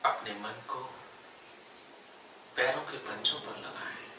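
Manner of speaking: unhurried yoga nidra guidance with long pauses; guided meditation. Real, not acted. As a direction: guide them gently into stillness, phrase by phrase. Leads yoga nidra body relaxation. (ठहर-ठहर कर योग निद्रा का निर्देश)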